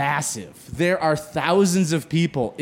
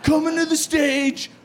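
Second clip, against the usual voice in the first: hyped event announcer; 8 semitones higher than usual. Retold or imitated. Host to the gaming crowd, booming hype announcer. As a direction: sell the comic like an arena announcer.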